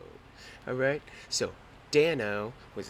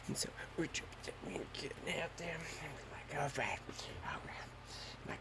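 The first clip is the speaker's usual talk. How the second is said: much softer, breathy hush; hushed retelling; 2 semitones higher, 11 dB quieter than usual. Retold or imitated. Describing inching onto the wing, hushed. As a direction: creep through it in a hush.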